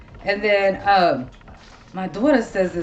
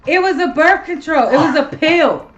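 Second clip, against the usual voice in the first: high, loud outburst of recognition; real excited realisation; 8 semitones higher, 5 dB louder than usual. Real, not acted. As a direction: exclaim it as it suddenly clicks. Sudden laughing realisation about the word.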